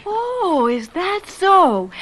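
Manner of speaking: high, slow indignant retort. Acted. Fiancée bristles at being told whom to see, slow indignant. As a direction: bristle and draw it out.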